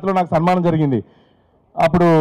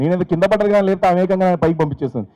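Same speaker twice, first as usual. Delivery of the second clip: ordinary delivery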